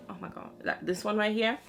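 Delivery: ordinary talk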